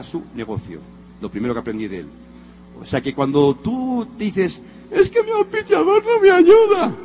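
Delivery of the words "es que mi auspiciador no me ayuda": high, whining mock complainer, near tears; whiny complaint. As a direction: mimic a self-pitying excuse, high and whining. Mocking a complaining distributor.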